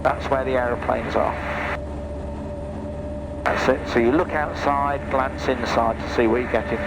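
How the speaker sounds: ordinary talk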